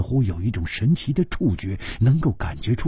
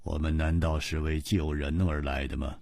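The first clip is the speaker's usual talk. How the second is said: low, soft, cold reply; lower, cold, indifferent man; Ji Bingyan's voice; 7 semitones lower, 8 dB quieter than usual. A narrator's reading. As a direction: brush it off, unmoved.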